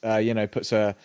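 normal talking voice

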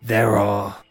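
very slow, grave tree voice; ancient tree. Acted. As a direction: warn slowly like a wronged old forest. Tree warns of axes.

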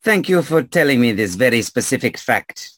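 put-on French-accented thanks. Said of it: "stranger with a French accent".

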